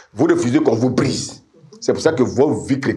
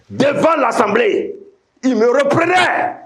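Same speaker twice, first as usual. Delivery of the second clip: high, drawn-out preacher's cry; emphatic preaching; 13 semitones higher, slower than usual. Real, not acted. Preacher telling how his mentor rebuked him publicly, emphatic preaching. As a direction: raise the pitch and stretch each phrase.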